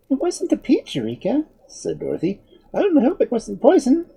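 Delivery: put-on high-pitched little girl; a young girl's high voice, at normal volume; Dorothy. A narrator's reading. Girl correcting her kitten, high and girlish.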